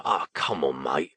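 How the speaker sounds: low, coaxing Cockney; thick Cockney accent. A narrator's reading. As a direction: coax a mate in Cockney. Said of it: "in a thick Cockney accent".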